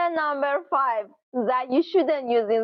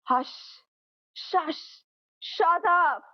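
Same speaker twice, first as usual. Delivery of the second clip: rude shushing; 4 semitones higher, 3 dB quieter, slower than usual. Shown as a demonstration. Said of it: "rude expression".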